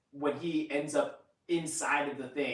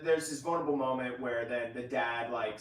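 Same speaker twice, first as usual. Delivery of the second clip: normal talking voice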